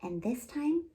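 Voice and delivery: put-on lively, expressive read-aloud for little kids; storytime narration. A narrator's reading. Children's librarian reading a fairy tale to kids, lively storytelling.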